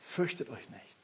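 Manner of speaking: a quiet, tender angel's reassurance; soft angel voice. Retold or imitated. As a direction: breathe it out gently like an angel. Quotes angels softly.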